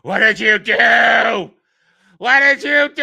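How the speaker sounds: slow, loud hammed-up shout; mock melodramatic shout. Reviewer parodying Wendy's dramatic outburst as a slow, loud shout. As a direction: overact the outburst like bad drama. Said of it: "at the end of it, there's a".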